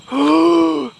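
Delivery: sudden startled gasp; a real sharp intake of breath. Real, not acted. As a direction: caught off guard, gasping. Startled in dark woods.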